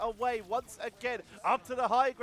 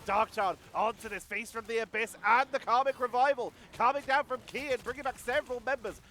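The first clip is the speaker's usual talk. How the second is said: higher, louder excited caster; live esports play-by-play; 5 semitones higher, 5 dB louder than usual. Real, not acted. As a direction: jump urgently into the action.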